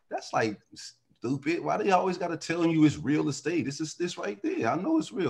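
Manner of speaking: lightly voiced kid questioning the obvious; indignant kid. Man recalling his six-year-old self puzzled by real estate, indignant. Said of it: "like, six and seven, but I felt like".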